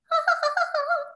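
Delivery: squeaky falsetto laugh; a high silly squeal of a laugh. Acted. Impressionist warms up with a squeal.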